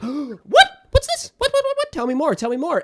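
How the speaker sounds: very high, loud frantic questions; mock shocked viewer. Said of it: "And you're like".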